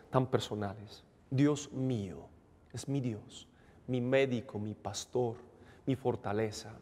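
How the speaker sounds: ordinary talk, nothing marked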